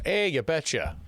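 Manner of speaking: high, playful mock Minnesota accent; imitated Minnesota accent. Host remembers the show Fargo, mock Minnesota accent. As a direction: put on a folksy Minnesota accent. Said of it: "The accent?".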